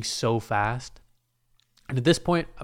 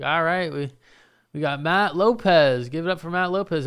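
emcee announcement; 5 semitones higher, 4 dB louder than usual. Retold or imitated. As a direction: bring the comic on stage as host. Emcee announcing the next comic.

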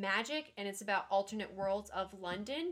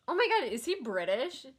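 surprised; 3 semitones higher, 7 dB louder than usual. Retold or imitated.

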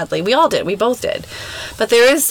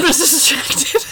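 laughing; 8 semitones higher, much slower than usual. Real, not acted. Teasing host about distraction.

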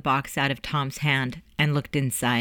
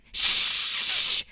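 long, sharp hushing shh; urgent unvoiced shushing sound; angry shush. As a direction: shush a friend angrily. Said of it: "Tom stammered angrily".

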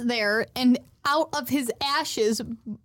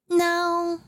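higher declaration, mock-heroic; mock earnest refusal; 6 semitones higher, 3 dB louder, slower than usual. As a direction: declare it as a resolute character. Acting Apis's firm refusal.

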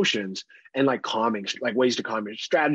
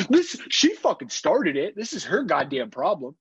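mock outrage through laughter; 6 semitones higher than usual.

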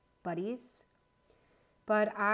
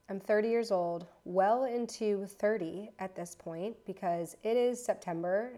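normal talking voice

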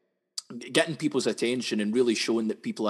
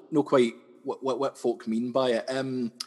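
usual speaking voice

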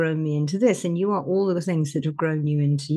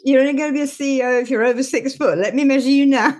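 laughing mock rule; 7 semitones higher, 3 dB louder, faster than usual. Mocking CEO height rule.

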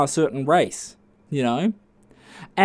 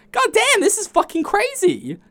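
high, squeaky exasperation; high-pitched this is fucking crazy; incredulous outburst. Real, not acted. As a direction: squeal it in disbelief.

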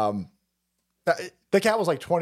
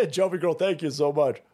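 normal talking voice

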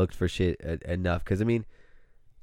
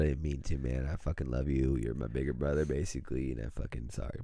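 sincere apology; 7 semitones lower, 12 dB quieter than usual. Real, not acted. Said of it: "I'm sorry that I yelled".

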